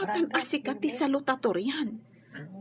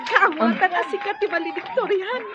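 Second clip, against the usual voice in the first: excited joy; 8 semitones higher, 5 dB louder than usual. Acted. Mother overjoyed at son's honor.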